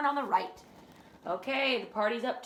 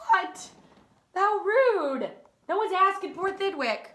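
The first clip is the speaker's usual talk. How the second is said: high, loud outraged how rude; indignant; 7 semitones higher, 5 dB louder, slower than usual. Real, not acted. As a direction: protest on the moose's behalf. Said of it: "outraged aside".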